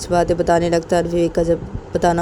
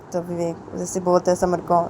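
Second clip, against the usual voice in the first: usual speaking voice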